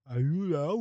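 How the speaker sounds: loud, high mocking South Philly accent; mocking a South Philly local with a loud put-on accent. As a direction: ham up a thick South Philly accent, like rocks in the mouth. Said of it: "If you sound like you have a ba-, bag of rocks in your mouth".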